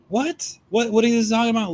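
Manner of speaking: high disbelieving "What?" then a fast rush; can't believe what he's hearing; scoffing disbelief; incredulous what. Real, not acted. Incredulous at the legal threat.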